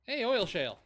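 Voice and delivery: excited. Real, not acted. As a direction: call out the discovery. Spots a resource.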